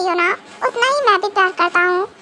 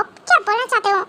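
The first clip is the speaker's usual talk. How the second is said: ordinary talk, nothing marked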